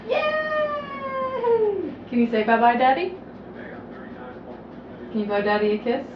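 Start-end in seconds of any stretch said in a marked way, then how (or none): 0.1-1.9 high happy cheer. Real, not acted. Cheering the toddler.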